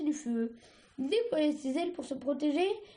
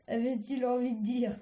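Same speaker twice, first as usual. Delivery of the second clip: ordinary delivery, nothing marked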